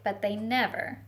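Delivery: put-on expressive picture-book reading for children; storytelling read-aloud. Teacher reading a picture book to the class, storytelling voice. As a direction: paint the story for a class of little ones.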